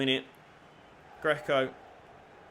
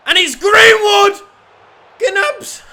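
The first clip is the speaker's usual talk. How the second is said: much higher, louder excited yell; goal celebration shout; 14 semitones higher, 14 dB louder, slower than usual. Real, not acted. Cheering Greenwood's goal for his team, a big high excited shout. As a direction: scream the scorer's name with joy.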